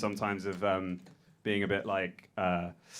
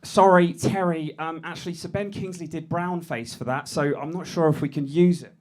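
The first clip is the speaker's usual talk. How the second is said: higher apologetic voice, voice piped up; nervous junior writer; 7 semitones higher, 7 dB louder than usual. Pretend. Junior writer correcting Terry, high and apologetic. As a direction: politely correct the boss. Timid colleague in sketch.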